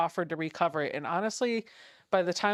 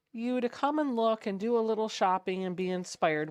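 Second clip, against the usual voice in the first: ordinary talk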